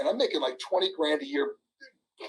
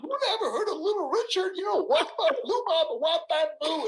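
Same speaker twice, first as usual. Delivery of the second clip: laughing retold quote; 9 semitones higher than usual. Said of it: "So I go".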